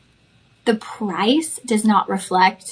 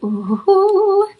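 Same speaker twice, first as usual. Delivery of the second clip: delighted ooh; 9 semitones higher, 6 dB louder than usual. Real, not acted. Reacting to new gemstone.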